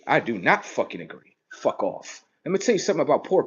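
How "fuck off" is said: breathy whispered brush-off; under-the-breath fuck off; whispered dismissal. Real, not acted. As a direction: whisper it like a throwaway insult. Dismissive aside after a rant.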